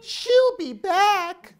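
big sister owl calling for Mommy; worried big sister owl. Acted. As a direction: ask for Mommy in a fretful high voice. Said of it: "said Sarah".

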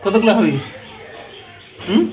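put-on high, loud baby talk to a pet; high coaxing pet voice. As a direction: tease the pet sweetly. Talking to the cat eating.

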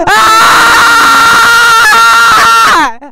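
loud piercing shriek; terrified scream. Retold or imitated. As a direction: shriek at the top of the lungs. Screams while upset about the game.

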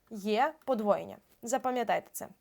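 ordinary talk, nothing marked

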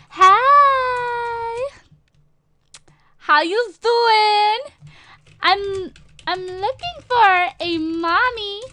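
high, loud, drawn-out sing-out greeting; trolling kid. Pretend. Streamer trolling free housing as an orphan, hammed-up high voice. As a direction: stretch every word in a put-on high voice.

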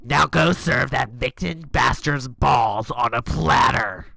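put-on slow, loud old grandma. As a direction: spit it out like a fierce granny. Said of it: "so great in Grandma's voice".

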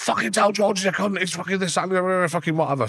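mock Charlie rant on the phone; angry Charlie. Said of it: "Charlie rings him up".

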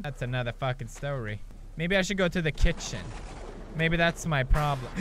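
usual speaking voice

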